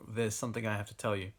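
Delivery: usual speaking voice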